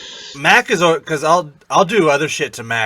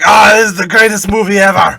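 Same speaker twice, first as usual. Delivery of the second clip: high, loud Austrian accent imitation; hammed-up Austrian accent boast; Arnold impression; 8 semitones higher, 11 dB louder than usual. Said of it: "and he's like".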